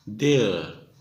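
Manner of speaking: "देयर" said like "there", one syllable, falling; slow, clear "देयर" for they're; teaching pronunciation. Shown as a demonstration. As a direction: model "देयर" exactly like the word there. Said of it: "तो इसको बोलते हैं देयर".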